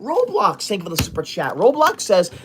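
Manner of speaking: ordinary talk, nothing marked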